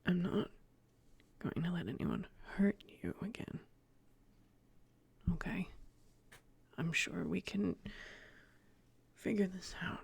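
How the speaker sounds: soft, halting near-whisper; quiet comfort for a crying mother; hushed reassurance. Acted. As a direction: murmur a protective promise, pausing between phrases. Son reassures his crying mother.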